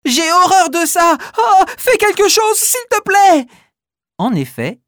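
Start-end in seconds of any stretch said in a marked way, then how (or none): 0.0-3.5 high, loud, panicky pleading; panicked girl. Acted. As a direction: beg in a fright. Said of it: "hurle Malika".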